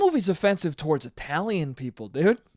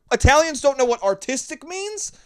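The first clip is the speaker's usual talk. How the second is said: indignant disbelief; 8 semitones higher, 3 dB louder than usual. Retold or imitated. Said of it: "And I'm like".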